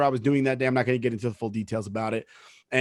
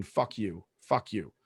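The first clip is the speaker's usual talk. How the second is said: inner resentment; 6 semitones lower, 8 dB quieter, slower than usual. Retold or imitated. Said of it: "in their head, they're thinking".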